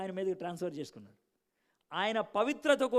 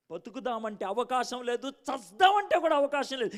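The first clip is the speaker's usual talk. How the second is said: rising desperation; 6 semitones higher, 7 dB louder than usual. Retold or imitated. Retold despair rising to friend.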